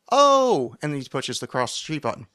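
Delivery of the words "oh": sudden realization. Retold or imitated. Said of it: "He goes".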